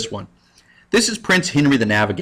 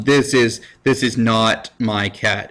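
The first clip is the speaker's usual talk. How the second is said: ordinary delivery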